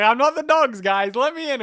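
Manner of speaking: high, loud mock plea; retold high-pitched pleading shout; acted protest. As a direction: protest loudly, pitch jumping up.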